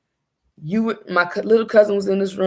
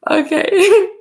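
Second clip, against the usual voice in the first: high, loud tearful okay; real crying; 12 semitones higher, 6 dB louder than usual. Real, not acted. Mother missing her babies, crying. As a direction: voice cracking into tears.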